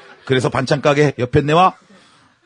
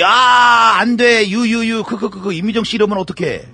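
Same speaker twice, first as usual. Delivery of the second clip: high, loud wail easing off; hammed-up pleading; mock despair; 6 semitones higher, 4 dB louder, slower than usual. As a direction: protest the cliffhanger in playful agony. Host's mock despair at the cliffhanger.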